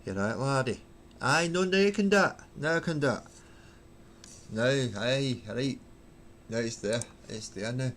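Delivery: lightly put-on Glasgow accent, egging on; Glaswegian accent. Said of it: "Do it in a Glasgow accent".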